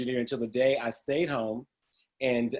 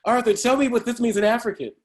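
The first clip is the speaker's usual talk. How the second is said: classmates asking; 9 semitones higher, 5 dB louder than usual. Retold or imitated. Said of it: "they would say".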